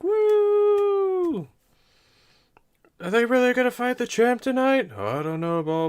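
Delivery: high, loud whooping patron chatter; cheering crowd. Acted. Tavern patrons gossiping about the champion fight, whooping. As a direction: cheer like a drunk fan.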